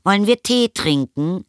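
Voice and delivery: high, singsong motherly call; rufende Mutter. A narrator's reading. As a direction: call an invitation through the house, pitched high. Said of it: "rief die Mutter". (hoch rufende Mutter)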